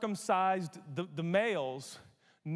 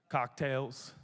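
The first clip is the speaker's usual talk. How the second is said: normal talking voice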